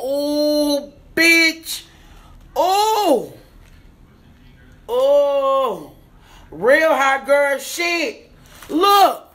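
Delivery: high, loud squeals of delight; squealing excitement. Real, not acted. Unboxing lashes for followers, high loud squeals of delight. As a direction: squeal like you just got the best gift.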